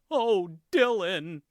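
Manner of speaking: co-host hears the SNL take on Brenda, hammed-up and drawn out; mock Brenda whine. High slow mock-dramatic Oh Dylan. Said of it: "their impression of Brenda was just her going".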